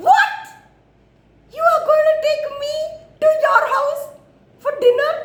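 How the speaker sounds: very high, loud squeal of disbelief; excited monkey voice at full pitch; thrilled monkey. Acted. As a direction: squeak it out, overjoyed and amazed. Monkey thrilled by dinner invitation.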